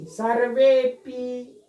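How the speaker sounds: Slow: सर्वेपि; सर्वेपि slowly, syllable by syllable; slow pronunciation demo. Shown as a demonstration. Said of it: "सर्वे plus अपि". (सर्वेपि को धीरे-धीरे बोलिए)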